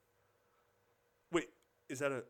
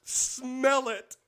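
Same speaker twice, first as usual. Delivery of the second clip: high-pitched laughter through words; crying with laughter; laughing hard; 12 semitones higher, 4 dB louder than usual. Real, not acted. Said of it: "hardest I've laughed".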